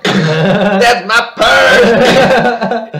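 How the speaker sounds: very slow, high, loud mock battle cry; retold Bobby yell. Bobby kicking the bully's friends, hammed-up yell. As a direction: holler it with each word stretched out. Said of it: "he yells out something he learned".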